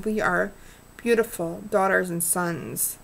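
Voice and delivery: usual speaking voice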